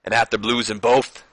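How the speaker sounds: measured wrestler promo with put-on accent; badly faked promo voice, phrase by phrase; Booker T. Champion admitting past losses to his rival, put-on accent. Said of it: "stop with the accent".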